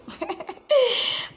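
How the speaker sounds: high, giddy giggle; real bashful laughter; embarrassed giggle. Real, not acted. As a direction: giggle shyly at your own crush. Giggling about her crush.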